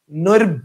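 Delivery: "নৈর্ব" as one piece ending in ব; first ব sounded. Shown as a demonstration. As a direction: stop "নৈর্ব" on a closing ব. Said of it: "একটা ব কিন্তু হয়ে গেছে". ("নৈর্ব"-এর শেষে ব-তে থেমে উচ্চারণ)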